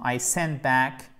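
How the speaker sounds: "I sent back" read slowly, short a in "back"; slow model phrase. Shown as a demonstration. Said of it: "je vais l'écrire correctement". ("I sent back" lent, a court)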